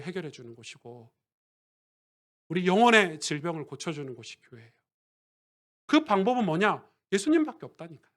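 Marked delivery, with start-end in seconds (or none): none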